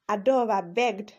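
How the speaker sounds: ordinary delivery, nothing marked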